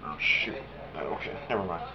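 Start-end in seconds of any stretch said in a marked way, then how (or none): none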